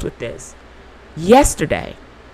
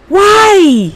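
pleading why; 12 semitones higher, 5 dB louder than usual. Real, not acted. Asking why she cried.

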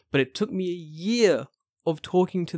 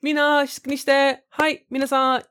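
high-pitched imitation of a teacher; sing-out classroom call in a high voice; really high teacher voice; 10 semitones higher, 3 dB louder than usual. Teacher calling her pupils, imitated really high voice. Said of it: "He's like, really high".

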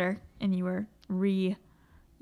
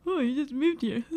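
mimicking a condescending mean girl; mock condescension; 5 semitones higher than usual. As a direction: parrot it back with snooty mock sympathy. Mimicking mean girl's condescending line.

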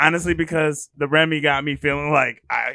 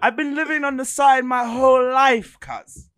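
higher-pitched mock British street slang; British accent; 7 semitones higher than usual. Shown as a demonstration. Host showing off accents for his co-host, mock British accent. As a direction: put on a punchy British roadman accent. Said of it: "check out the British one".

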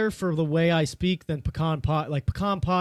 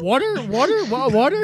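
mocking, higher and louder "Water?"; confused office listeners; 6 semitones higher, 5 dB louder than usual. Mocking podcast listeners at the office, puzzled and higher. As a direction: play puzzled coworkers repeating "Water?". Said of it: "They're all fucking at the office going".

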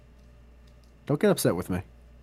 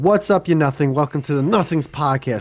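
hyped-up host intro read, louder and higher; podcast intro; 4 semitones higher, 4 dB louder than usual. Shown as a demonstration. Host greeting listeners at the top of the show, announcer voice. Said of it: "doing an intro".

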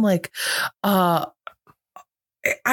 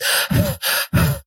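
imitated panting. Host mimicking an actor waiting for his next line, panting. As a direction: pant heavily like someone out of breath. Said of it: "fucking panting".

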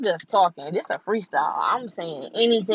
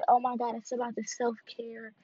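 lightly mocking imitation, softer and a bit higher; a hint of a gushy self-care crowd; mocking everybody; 4 semitones higher, 7 dB quieter than usual. Mimicking people who preach self-care, lightly mocking. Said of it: "everybody used to always say".

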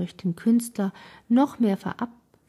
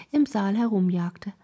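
plain reading voice

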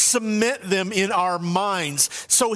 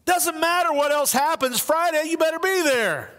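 higher, insistent stress on be; emphatic; 10 semitones higher than usual. Acted. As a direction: drive it home hard. Emphatic point about keeping promises.

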